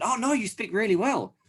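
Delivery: high, eager compliment; gushing praise. Retold or imitated. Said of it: "they said".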